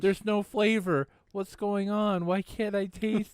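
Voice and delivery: put-on high, slow whiny kid voice; little kid voice. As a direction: whine it like a small child. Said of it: "Why did you do the little kid voice then?".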